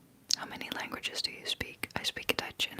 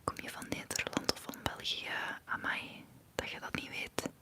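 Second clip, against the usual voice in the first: ASMR whisper. Real, not acted. Streamer answering chat comments to viewers, in an ASMR whisper.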